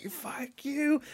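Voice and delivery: high, drawn-out furious yell; angry shouting. Retold or imitated. Podcaster re-enacting his rant at the TV screen, angry shouting. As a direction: yell it up at the screen, mock outrage. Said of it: "I was shouting at them".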